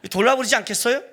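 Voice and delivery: ordinary delivery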